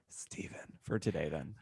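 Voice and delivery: playful conspiratorial whisper, no voice; mock whisper. Co-host teasingly withdraws her Steven bit, whispered. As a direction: breathe it out like a secret grudge. Jokingly sulks about Steven.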